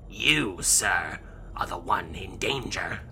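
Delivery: slow, breathy sorcerer's whisper; eerie whisper. Acted. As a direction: hush it like a sinister fortune teller. Necromancer warns Yu.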